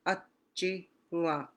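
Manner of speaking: Atjingwak slow, in separated syllables with a hard J; harder J sound. Shown as a demonstration. Teacher answering how the J is pronounced, harder J sound. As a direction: break Atjingwak up, J as in DJ. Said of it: "is a harder sound".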